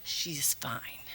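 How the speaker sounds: flat dismissive whisper. Retold or imitated. Vet staff whispering to the worried owner, dismissive. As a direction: breathe it out, barely voiced. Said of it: "They're like".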